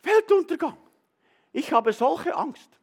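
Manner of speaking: woman bursts into church crying out in fear; panicked woman. Retold or imitated. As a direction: cry out like a terrified churchgoer. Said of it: "eine Frau ... gesagt".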